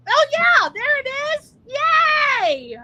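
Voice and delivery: high, bright cheer at the book reveal; excited cheer. Real, not acted. Host cheering at the book cover, high excited yay. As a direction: cheer it out, pitch way up.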